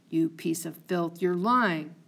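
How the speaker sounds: ordinary delivery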